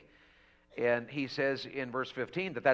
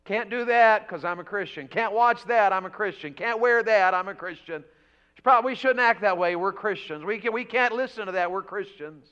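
emphatic preaching; 7 semitones higher, 9 dB louder than usual. Real, not acted. Preacher listing what Christians can't do, emphatic preaching. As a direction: drive each prohibition home, then drop for the reason. Lists rules of holy living.

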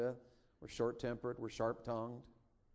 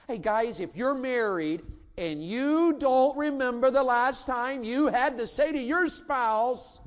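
calling out; 10 semitones higher, 8 dB louder, slower than usual. Real, not acted. Preacher calls out to men.

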